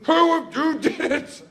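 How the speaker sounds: high, slow mock-angry mum voice; hammed-up shrieking mum; furious mum. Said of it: "She goes".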